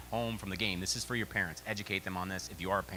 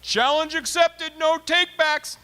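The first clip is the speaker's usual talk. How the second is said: put-on high, slow, loud child's voice; kid's gleeful taunt; 10 dB louder, much slower than usual. Father mimics youngest son taunting from back seat, gleeful high. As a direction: crow it back like a gleeful little kid. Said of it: "my youngest goes".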